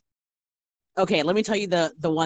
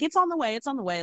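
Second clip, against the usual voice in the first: hurried reassurance; 9 semitones higher, faster than usual. Retold or imitated. Server retelling assurance to waiting customer.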